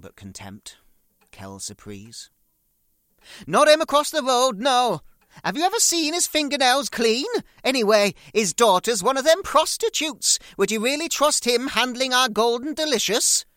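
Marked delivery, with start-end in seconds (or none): 3.5-13.5 very high, loud old-mother impression; shrill-free screech of an old mum complaining; loud hammed-up old mother voice; mother moaning. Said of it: "Mummy, don't talk stupid".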